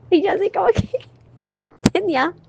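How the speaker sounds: high, giggly and sheepish; embarrassed laughing. Real, not acted. Telling Anita how shy she felt, giggling high. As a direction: laugh through the shy confession.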